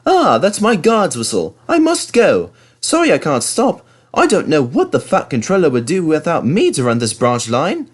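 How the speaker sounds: high, brisk, self-important engine; hurried little engine in a rush; bustling, pleased with himself; busy Thomas. A narrator's reading.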